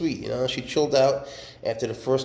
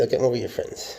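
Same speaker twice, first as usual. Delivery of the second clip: ordinary talk, nothing marked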